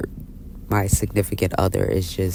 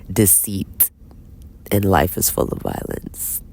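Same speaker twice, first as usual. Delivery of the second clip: normal talking voice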